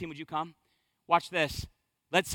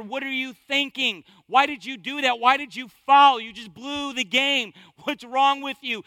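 louder, higher coach berating his player; coach yelling; 4 semitones higher, 6 dB louder than usual. Retold or imitated. Preacher acting an angry coach at a foul. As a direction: chew the player out from the sideline. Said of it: "The coach is going to his player".

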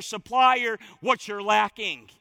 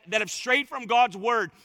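usual speaking voice

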